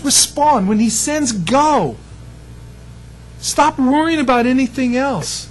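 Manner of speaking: high-pitched, urgent pulpit voice; rising sermon climax, pitched high; preaching exhortation. Real, not acted. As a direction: drive the commands home to the congregation.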